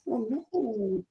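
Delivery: ordinary talk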